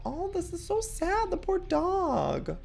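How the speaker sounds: very high, drawn-out pity; real high-pitched sympathy; pitying coo. Real, not acted. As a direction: coo sadly over a hurt animal. Pitying the hurt dog.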